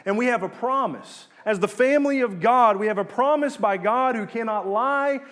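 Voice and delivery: emphatic preacher warning of judgment; forceful preaching. Real, not acted. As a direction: press each phrase home with urgency.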